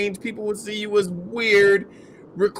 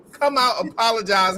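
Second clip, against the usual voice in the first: normal talking voice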